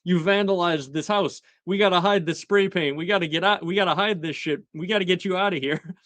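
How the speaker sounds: high, hurried mock panic, rushing at the end; a parent scrambling before the cops come, half-joking; imitating a parent frantically covering up a kid's mess; panicked parent. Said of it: "a parent trying to hide the evidence".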